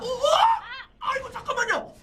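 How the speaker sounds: high laughing yelp of surprise; startled shout. Real, not acted. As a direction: caught off guard, laughing shout. Sudden play surprises caster.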